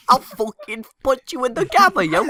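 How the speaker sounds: high, put-on British yob; mock British threat. Joking threat among friends, British accent. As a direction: play a caricature British tough.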